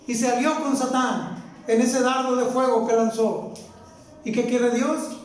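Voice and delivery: ordinary talk, nothing marked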